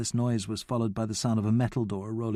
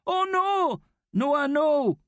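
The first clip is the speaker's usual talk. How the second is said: much higher panicked cry; alarmed shout; much slower than usual. A narrator's reading. Abdi shouting at Noah climbing the fence, much higher and alarmed. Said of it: "Abdi shouted".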